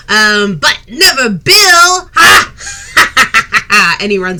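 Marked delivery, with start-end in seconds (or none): 0.1-2.5 high, loud gloating shout; cheeky child shouting he won; triumphant kid. Acted. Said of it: "kid pops up and goes". 2.6-3.9 put-on kid cackle. Kid runs off laughing.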